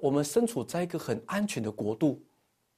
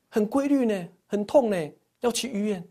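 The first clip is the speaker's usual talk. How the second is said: higher-pitched imitation of wife; mock urgency, pitched up; wife's urgent plea; 7 semitones higher, 3 dB louder than usual. Wife tells husband the pains are regular, urgent imitation.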